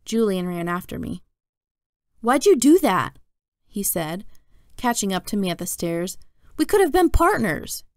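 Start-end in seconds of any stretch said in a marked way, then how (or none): 2.2-3.2 loud, higher-pitched protest; accusing kid, voice jumping up; indignant demand. A narrator's reading. Julian confronts Jack after chasing him. 6.6-7.8 high, loud whine of protest; indignant complaint. A narrator's reading. Julian complains to Jack.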